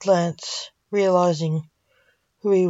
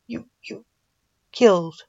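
crying; 4 semitones higher than usual. A narrator's reading. Father accusing son, crying and stammering. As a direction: fight through sobs to say it. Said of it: "Blake cried".

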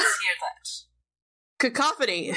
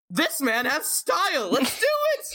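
gleeful rallying cry, last words shoot up; enthusiastic character; 4 semitones higher than usual. Acted. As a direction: jump in with eager delight.

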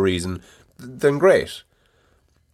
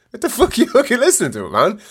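incredulous outburst; 12 semitones higher than usual. Retold or imitated. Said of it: "you're like".

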